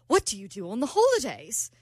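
put-on convincing amateur British accent. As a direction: imitate a curious British man. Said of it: "That was good, by the way".

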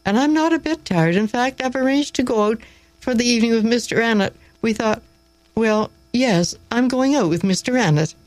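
ardent, high-pitched excited confession; Miss Gray. A narrator's reading. Young woman telling the teacher of her evening plans, ardent. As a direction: bubble over ardently, voice pitched well up. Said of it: "replied Miss Gray ardently".

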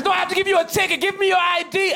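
high-pitched mock officer demand; insistent cop. Cop ticketing a jaywalker, high insistent demand. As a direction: push it out as an exasperated cop.

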